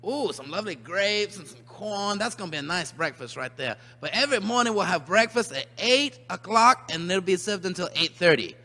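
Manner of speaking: ordinary delivery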